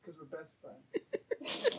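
hushed embarrassed denial; mock sheepish hush. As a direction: mumble the excuse shyly. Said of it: "they get like".

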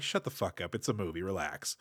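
a low, soft muttered shut-down; muttered retort. Retold or imitated. Telling the trivia nitpicker to relax, muttered. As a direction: grumble it under your breath.

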